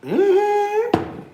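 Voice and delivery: high sassy mm-hmm; knowing, sassy agreement. Real, not acted. Smug agreement, high squeal.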